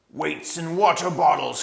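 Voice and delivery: furious dwarf swearing in the dark; angry dwarf. A narrator's reading. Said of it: "came an angry voice".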